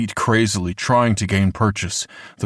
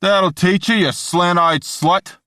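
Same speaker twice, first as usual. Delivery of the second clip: put-on loud, high jeering yell in a New York accent; New York shout; 10 semitones higher, 4 dB louder than usual. Bystander jeers at the hanged woman, loud New York shout. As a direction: hurl it like a hateful New York heckler. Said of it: "someone shouted in a thick New York accent".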